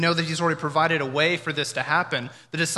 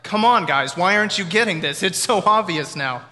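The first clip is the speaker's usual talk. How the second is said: louder, higher, impatient pleading; acted exasperation; 5 semitones higher, 8 dB louder than usual. Onlooker chiding the disciples for not getting it, exasperated. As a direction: push it out as an exasperated scolding.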